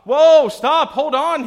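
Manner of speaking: loud and high, panicked; desperate yell. Acted. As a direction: scream to stop the horse at the cliff edge. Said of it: "he yells".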